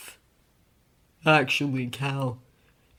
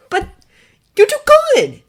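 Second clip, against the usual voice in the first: much higher, louder insisting; insistent Clark; 14 dB louder, faster than usual. A narrator's reading. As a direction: press the point hard. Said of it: "he insisted".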